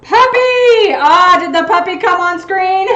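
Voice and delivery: high, loud squeal; excited puppy call. Real, not acted. Calls out to the puppy.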